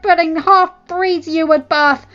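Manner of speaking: high, loud, spiteful; wife cursing the rival's children; venomous curse. Acted. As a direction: spit the curse at her.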